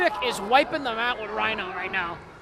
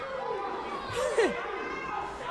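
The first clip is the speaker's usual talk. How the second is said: laughing; 8 semitones higher, 11 dB quieter than usual. Real, not acted. Co-host laughs at the joke.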